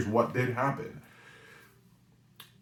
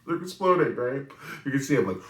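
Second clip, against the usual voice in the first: high, loud, laughing retelling; 7 semitones higher, 7 dB louder than usual. Real, not acted. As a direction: crack up while saying it. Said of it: "I'm laughing now".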